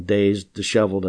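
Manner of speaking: usual speaking voice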